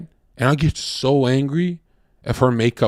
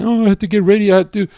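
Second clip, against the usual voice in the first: mock-innocent girlfriend pleading; whiny girlfriend excuse; 8 semitones higher, faster than usual. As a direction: parody her lame excuse.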